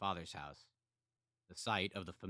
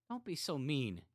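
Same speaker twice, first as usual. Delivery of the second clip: tearful; 9 semitones higher, 4 dB louder than usual. A narrator's reading. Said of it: "before a deluge of tears".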